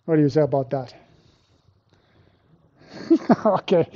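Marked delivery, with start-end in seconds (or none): 3.1-3.9 laughing okay. Real, not acted. Laughs at online vote result.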